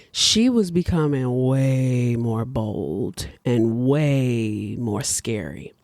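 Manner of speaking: very slow, low narration; drawn-out, foreboding storyteller; ominous emphasis. A narrator's reading. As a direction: stretch each WAY out long and low. Narrator dramatizes the growing menace.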